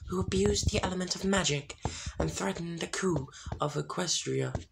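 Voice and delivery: judge her like an otherworldly spirit; spirits of harmony. A narrator's reading.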